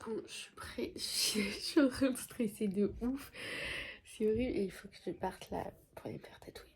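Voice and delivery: chuchotement un peu lent et nerveux; chuchoté, stressée. Real, not acted. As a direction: confier son stress à voix basse. Stressed before tattoo, whispering. (murmure nerveux)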